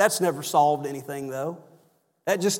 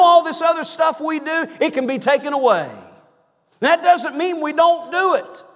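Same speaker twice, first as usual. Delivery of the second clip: higher, louder, emphatic preaching; fervent preaching; 7 semitones higher, 4 dB louder than usual. Acted. Preacher exhorting the church to put Jesus first, fervent. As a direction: preach it out to the back pews.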